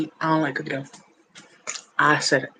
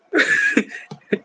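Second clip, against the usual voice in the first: high shrieking laugh. Real, not acted. Huge laugh.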